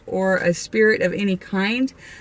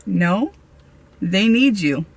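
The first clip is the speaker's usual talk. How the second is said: normal talking voice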